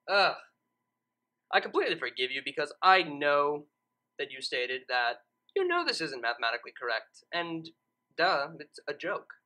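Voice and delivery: usual speaking voice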